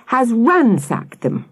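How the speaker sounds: high, drawn-out dismay; breathless alarm. A narrator's reading. Missus Webb reporting ransacked tents, breathlessly dismayed. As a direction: stretch it out, aghast. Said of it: "she added breathlessly".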